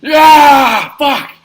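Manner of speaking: very high, loud agonized yell; drawn-out shriek, then a shouted curse; pained monster scream. Acted. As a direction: scream as if hit by holy fire. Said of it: "and he's like".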